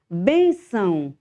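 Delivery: Benção stretched slowly, bem clear and closed; slow model word. Shown as a demonstration. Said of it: "o bem, usamos o acento circunflexo".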